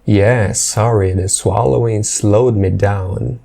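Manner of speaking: "Yeah, sorry, the swallowing slowed me down" said slowly and clearly; slow teaching repeat. Shown as a demonstration. Teacher repeats the clip line slowly for the class. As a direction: read the line slowly for students. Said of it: "repeats clip slowly for learners".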